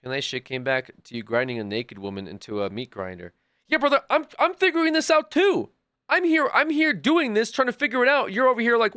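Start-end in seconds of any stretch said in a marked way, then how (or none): 3.7-9.0 raised, higher exasperated rant at chat. Real, not acted. Streamer ranting at a viewer who came back, exasperated and raised. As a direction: vent at the viewer, voice pitched up. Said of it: "He's yelling".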